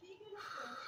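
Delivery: long weary whispered sigh; tired sigh. Real, not acted. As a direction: let out a tired breath. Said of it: "I got tired of talking".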